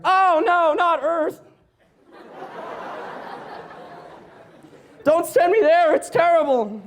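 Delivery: high, whiny begging voice; pleading teenager. Retold or imitated. Teen Jesus begging his dad not to send him to Earth. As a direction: beg like a dramatic teen.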